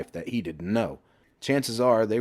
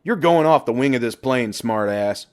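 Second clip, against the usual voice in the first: mock threat; 5 semitones higher, 7 dB louder than usual. A narrator's reading. Mock threat after scream.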